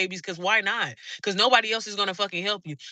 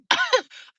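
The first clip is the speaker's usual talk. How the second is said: sudden shrieking laugh, much higher; high burst of laughter; 4 dB louder than usual. Real, not acted. Laughing at her own rant to viewers, a high shriek of laughter.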